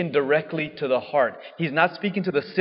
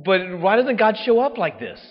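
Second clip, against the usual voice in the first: higher, louder burst; mock demand for a grand sign; dramatic; 7 semitones higher, 6 dB louder than usual. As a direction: throw it out big. Big gesture, louder.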